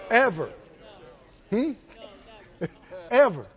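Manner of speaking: usual speaking voice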